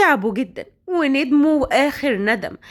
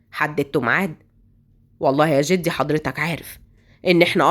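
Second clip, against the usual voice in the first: much lower, grave family talk; old grandfather and grandson; 8 semitones lower than usual. Acted. Grandfather and Jabal discussing the wedding, low and grave. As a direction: speak like a village elder weighing a marriage.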